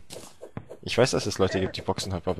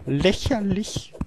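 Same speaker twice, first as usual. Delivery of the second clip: drawn-out scoff; 10 semitones higher, much slower than usual. Acted. Mock dismissal.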